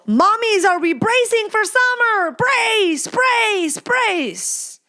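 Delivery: high-pitched call to brace; mock rallying call. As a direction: call out high, like a comic emergency warning.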